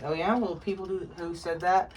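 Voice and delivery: sarcastic high challenge; challenging. Real, not acted. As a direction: call out the critics, sarcastic. Challenging critics.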